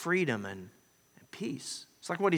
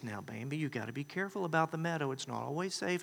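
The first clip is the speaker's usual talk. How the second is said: gentle mother; 8 dB quieter than usual. Retold or imitated. Mama deer instructs Bambi.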